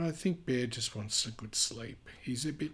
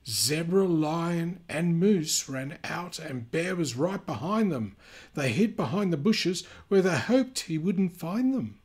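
lively storybook reading voice; animated read-aloud for kids; reading to children; 4 semitones higher, 7 dB louder than usual. A narrator's reading. Reading picture book aloud.

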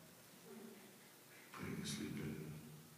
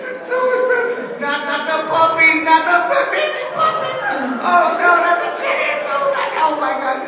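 mock wailing; 10 semitones higher than usual. Acted wailing over puppies and kittens.

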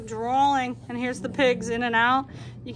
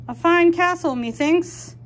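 higher, louder, pompous admiration; grand old-fashioned voice; 7 semitones higher, 6 dB louder than usual. Acted. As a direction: speak like a courtly knight. Dragon admires the house.

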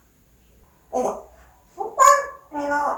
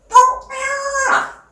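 high coaxing pet voice; 11 semitones higher, 4 dB louder than usual. Real, not acted. Coaxing the bird to talk.

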